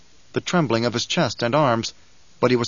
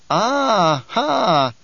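triumphant ah-ha; 8 semitones higher, 4 dB louder than usual. Acted. Bijaz exclaims.

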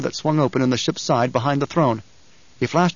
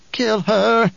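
put-on muttering old woman; 9 semitones higher, 3 dB louder than usual. A narrator's reading. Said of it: "the old woman muttered".

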